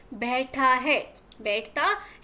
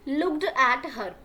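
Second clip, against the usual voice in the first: slow read-aloud; much slower than usual. Shown as a demonstration. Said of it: "teacher reads the English line".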